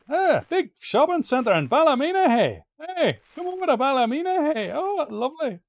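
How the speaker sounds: high, loud Ballymena accent caricature. Shown as a demonstration. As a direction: call out in an over-the-top Ballymena accent. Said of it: "That's a horrible accent".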